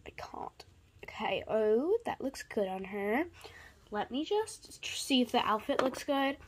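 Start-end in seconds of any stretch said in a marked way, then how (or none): 0.1-0.6 tiny whispered I can't; overwhelmed whispered aside; breathless whisper. Real, not acted.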